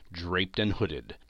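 usual speaking voice

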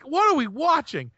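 wife catching a gross scene on TV, high mock alarm; mock appalled wife. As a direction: squeak it out like the horrified wife. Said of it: "she's like".

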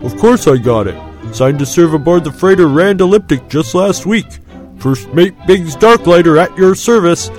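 loud, breezy bluster; a lightly voiced evasive pilot. A narrator's reading. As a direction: brush it aside a little too fast. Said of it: "something evasive about Biggs".

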